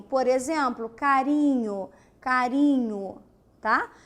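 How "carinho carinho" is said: carinho said slowly with a light tapped r; weak r. Shown as a demonstration. Teacher showing the weak r in carinho, slow and clear. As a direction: say carinho slowly, flicking the r gently. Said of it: "R minúsculo é o R fraquinho".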